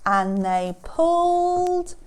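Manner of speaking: slow, high, loud stretched narration; straining effort. A narrator's reading. Storyteller as animals tug the nose, straining. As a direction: stretch "pulled" as if heaving.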